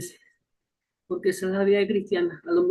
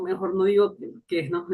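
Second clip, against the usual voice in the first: ordinary talk, nothing marked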